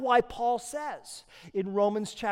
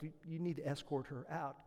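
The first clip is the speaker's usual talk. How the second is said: quiet order; 6 semitones lower, 16 dB quieter than usual. Acted. Judge to bailiff.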